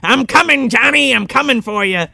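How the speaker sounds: high, hammed-up taunt from a truck; mock-gleeful movie shout; gleeful pursuing villain. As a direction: imitate a villain calling out mid-chase. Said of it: "honking the horn going".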